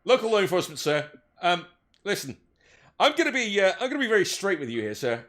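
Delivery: officer at the door, formal and careful; elf police officer. Acted.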